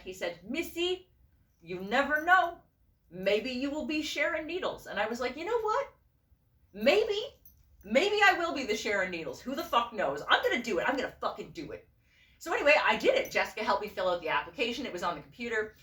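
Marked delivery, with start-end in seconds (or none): 0.4-4.9 high-pitched imitated Quebecois accent; cute Quebecois accent. Designer friend encouraging the speaker, Quebecois accent. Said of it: "in his cute Quebecois accent". 5.4-11.9 high, questioning start settling into determination; building resolve. Retold or imitated. Answering the encouraging friend, newly determined. Speaker decides to go for it.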